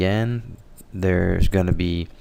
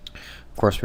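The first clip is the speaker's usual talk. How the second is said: ordinary talk, nothing marked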